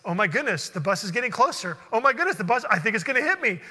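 loud, rapid, mounting alarm; retold panic. Retold panic as bus approaches.

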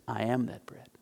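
a low, soft statement; quiet first take. Acted. Jesus answering the crowd, low and soft. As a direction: say it quietly, almost to yourself. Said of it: "And Jesus says".

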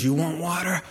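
mock the people as Moses striking the rock; furious Moses. Moses furious at the thirsty people, sneering. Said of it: "He is furious".

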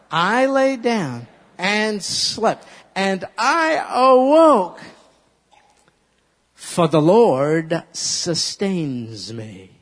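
loud, slow, rising to awoke; whole verse, drawn out; slow recitation. Shown as a demonstration. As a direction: proclaim the verse slowly. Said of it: "Let's say the same, the whole thing".

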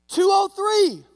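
high, loud eager shout; the new guy bravely shouts his joke; hopeful loud call out the window; yelled number. Retold or imitated.

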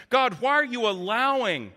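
high, urgent questioning of God; lamenting plea. Retold or imitated. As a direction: cry out why, pitch lifted. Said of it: "Surely that must have been the sentiment".

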